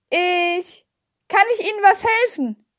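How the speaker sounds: loud, high-pitched offer; calling up. A narrator's reading. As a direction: raise your voice so a deaf man hears. Child calling up to deaf man.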